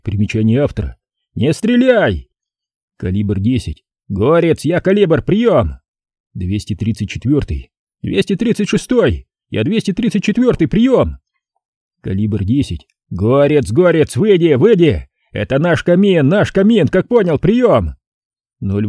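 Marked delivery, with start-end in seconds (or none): 1.3-2.3 loud, high shout over radio; crackly military radio. Retold or imitated. Radio call under fire. 4.1-17.9 loud, high radio call-out; crackly military radio. Retold or imitated. Radio call under fire.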